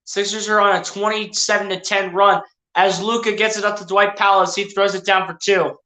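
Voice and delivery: ordinary talk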